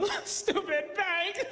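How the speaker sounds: high, laughing mock whine at the bank; mock complaint. Pretend borrower grumbles about bank papers, laughing mock whine. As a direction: ham up a silly grumble through laughter. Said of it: "You don't go".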